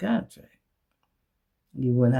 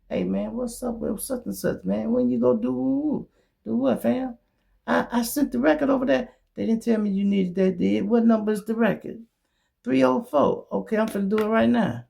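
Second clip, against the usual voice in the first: lightly voiced phone chat, higher; acted phone call; 7 semitones higher than usual. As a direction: play both sides of a quick call.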